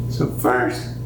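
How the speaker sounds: ordinary delivery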